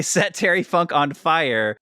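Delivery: usual speaking voice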